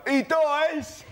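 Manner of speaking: put-on high yelled drunken taunt; drunk passer-by. Drunk passer-by spoiling the ending to the queue, mock high yell. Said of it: "someone who's drunk would just wander past and go".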